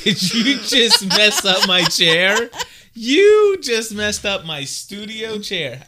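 high, loud, slow laughing protest; laughing outrage. Real, not acted. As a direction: laugh out a loud, drawn-out complaint. Chair messed up, laughing outrage.

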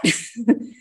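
short, high nervous laugh. Real, not acted. Storyteller reliving the lawyer meeting, laughing nervously. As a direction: let out a brief, uneasy high laugh. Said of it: "I had this nervous laugh".

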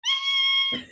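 imitated piercing whine, very high; loud high tone in the ears; high-pitched ringing. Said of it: "it goes".

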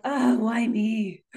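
slow, sulky self-pity; a drawn-out pouty sigh of a question. Shown as a demonstration. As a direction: whine it like poor, poor me. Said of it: "in kind of a pouty way".

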